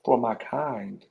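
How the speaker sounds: slow, soft, stunned repeat; stunned low repeat. Real, not acted. Repeating the colonel's words to himself, stunned and quiet. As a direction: let it sink in, slow and quiet. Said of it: "I automatically went back to slavery time".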